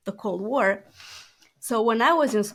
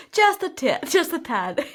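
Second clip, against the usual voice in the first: laughing; 8 semitones higher, 5 dB louder than usual. Real, not acted. Laughing reply about her accent.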